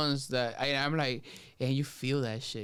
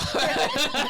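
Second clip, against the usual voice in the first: loud laugh; 6 dB louder than usual. Real, not acted. Teller laughing at his own revenge story, loud laugh. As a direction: burst of high loud laughter.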